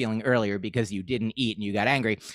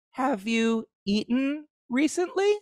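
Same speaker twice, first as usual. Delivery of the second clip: high, slow, gentle caring voice; gentle and kind; 10 semitones higher, slower than usual. Retold or imitated. Girlfriend gently asks her cranky partner, high and tender. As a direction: soften it way up like an angel checking on you. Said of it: "in a very gentle, generous, kind, compassionate way".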